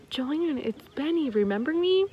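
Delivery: small, hopeful voice asking to be remembered; little boy on phone. Acted.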